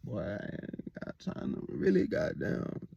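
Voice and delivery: a hushed grumbled whisper; whispered aside. Retold or imitated. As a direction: breathe it under your breath like you don't want to stay. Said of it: "I'm like".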